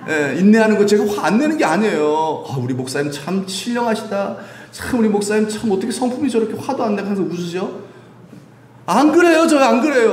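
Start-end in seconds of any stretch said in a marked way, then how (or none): 2.5-7.8 higher-pitched imitation of a gushing admirer; playful mimicry of church members' praise; admiring churchgoer. Voicing admiring church members about him. 8.9-10.1 loud, high-pitched denial; emphatic protest. Real, not acted. Protesting the imagined praise.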